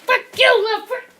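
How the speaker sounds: high, loud angry yell; high furious yell; mad outburst. Real, not acted. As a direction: snap at the thing that hit you. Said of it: "Sorry, I got mad".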